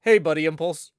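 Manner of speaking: loud, forceful greeting in a put-on voice; [name]. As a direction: call out as your buddy's impersonator. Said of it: "it's me, [name]".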